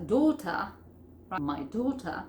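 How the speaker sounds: British "daughter" with crisp T and no final R; British pronunciation. Shown as a demonstration. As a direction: say "daughter" like British "daw-tuh". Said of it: "that is British pronunciation".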